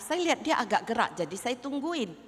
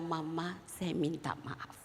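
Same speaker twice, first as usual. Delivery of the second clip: soft unvoiced whisper, tearful; whispered apology; 4 semitones lower, 11 dB quieter, slower than usual. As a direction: whisper the apology like a sorry teen. (anak berbisik minta maaf sambil menangis)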